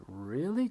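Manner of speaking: "Really?" lifted high before a drop; rising "Really?". Shown as a demonstration. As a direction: ask "Really?" with a high lift. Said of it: "It goes down".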